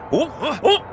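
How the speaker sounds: playful mock teasing. Balder teases blind brother.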